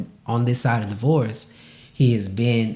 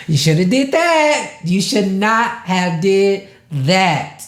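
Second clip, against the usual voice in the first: mock scolding friend; 8 semitones higher, 5 dB louder than usual. Friends calling him on his BS.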